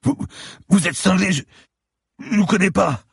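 a beaten lawyer gasping in fear; frightened bleeding man pleading with the cop; terrified captive. Acted.

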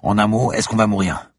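loud, impatient demand; virulent. Acted. Inspector demanding the professor get to the point. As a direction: press him hard for an answer. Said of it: "cracha un inspecteur virulent".

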